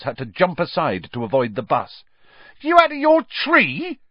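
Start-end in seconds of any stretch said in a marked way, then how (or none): 2.6-4.0 very high, loud shocked yelp. A narrator's reading. Stan reacting to Harry naming Voldemort, yelping. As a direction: yelp it in alarm, pitched right up. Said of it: "yelped Stan".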